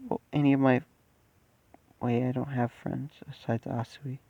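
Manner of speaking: soft inner monologue, understated; quiet despairing thought. Acted. Boy alone thinks his life is ruined, quiet despair.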